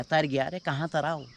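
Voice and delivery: usual speaking voice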